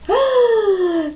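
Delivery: hammed-up storyteller gasp; mock gasp. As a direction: gasp as if startled, for the kids. Pretend fright at spider picture.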